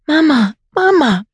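put-on a scared little boy crying for his mother; crying child. Child cries for shot mother.

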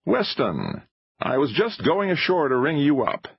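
high-pitched, impatient greeting. A narrator's reading. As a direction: launch straight in, impatiently. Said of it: "an impatient voice said without preliminary".

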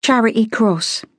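put-on slow, high Cockney girl; reluctant street-girl voice. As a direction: add it grudgingly, drawn out. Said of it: "she said reluctantly".

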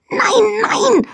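high squeaky protest; a tiny voice crying out; protesting small voice. A narrator's reading. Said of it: "protestierte die leise Stimme".

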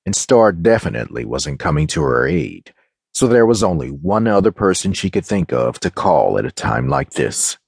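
ordinary delivery, nothing marked

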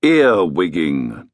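ordinary delivery, nothing marked

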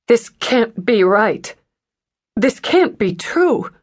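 woman shaken by the news, rising stunned disbelief; stunned denial. A narrator's reading. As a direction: voice climbing as it sinks in. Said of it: "trembles in the hands".

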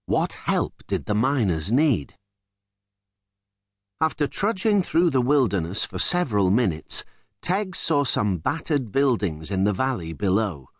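ordinary delivery

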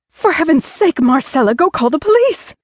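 very high, shrill frantic shout; screech. A narrator's reading. Wife screeching at the paralyzed housekeeper, frantic. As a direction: screech at the frozen maid. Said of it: "the wife screeched".

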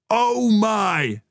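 high, excitable American commentator; hyped-up sportscaster cry; American commentator shout. A narrator's reading. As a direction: exclaim like a ringside commentator. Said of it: "shouted back in his American commentator's voice".

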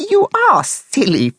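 very high, tittering guinea pig; giggle, then tease in a high voice; squeaky-high amused teasing; giggling. A narrator's reading. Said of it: "Suddenly she started giggling".

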